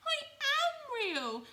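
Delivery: very high, loud, hurt toy rabbit; squeaky loud wounded little rabbit; near tears, little rabbit. Acted. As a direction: protest it high and loud, nearly crying. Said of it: "said the little rabbit".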